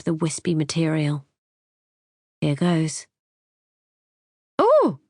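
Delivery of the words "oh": sudden high squeal of surprise. A narrator's reading. Wife finding something under the car seat, high squeal. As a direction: squeak out a startled oh. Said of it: "I squeal".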